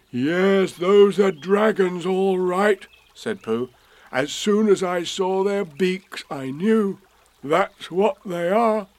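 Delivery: slow awed teddy bear; excited Pooh. Acted. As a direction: Pooh sure he's spotted dragons.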